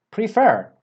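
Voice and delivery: prefer, high, stress on the second syllable FER. Shown as a demonstration. Teacher modelling the word prefer, stress on FER. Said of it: "The stress comes on the second syllable".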